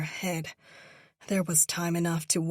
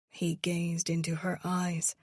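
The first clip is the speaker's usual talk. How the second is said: ordinary delivery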